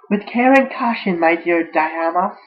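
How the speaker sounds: high, loud put-on voice; filly character voice. Voicing a filly in fan fiction.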